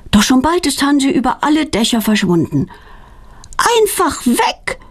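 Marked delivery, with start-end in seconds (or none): 3.8-4.8 put-on high, slow exasperated child's voice; peeved, drawn-out high complaint; annoyed. A narrator's reading. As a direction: say it put out and frustrated. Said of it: "ärgert sich Claudia".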